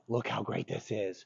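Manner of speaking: positive contrast. Shown as a demonstration. Trainer showing a dog the good option, positive tone. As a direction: drop the volume and make it inviting. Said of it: "there's contrast".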